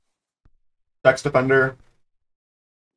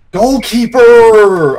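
long, high, loud shout; exasperated yell; 8 semitones higher, 10 dB louder, much slower than usual. Real, not acted. Gamer fed up with AI goalkeeper, exasperated yell. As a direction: yell it slowly at the useless keeper.

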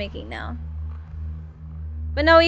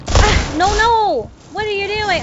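sudden high yelp of alarm, louder; alarmed shout; 6 semitones higher, 5 dB louder, slower than usual. Real, not acted. Gamer yelling at an enemy who ambushes him, alarmed shout. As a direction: cry out as an enemy jumps you.

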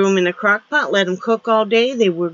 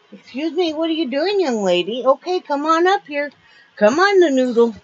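high sing-song voice for the pet; pet talk; 7 semitones higher than usual. Real, not acted. Owner calling her dog up, high pet-talk voice.

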